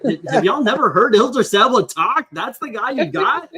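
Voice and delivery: can't believe what they did; incredulous. Retold or imitated. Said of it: "And I'm like".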